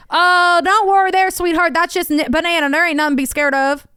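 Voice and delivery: Southern clerk. Retold or imitated. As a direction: soothe her like a good-old-boy clerk. Voicing gas station clerk.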